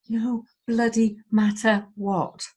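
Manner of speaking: ordinary delivery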